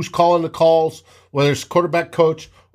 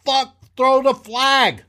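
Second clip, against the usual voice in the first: mock yell; 9 semitones higher, slower than usual. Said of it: "when he yelled at him".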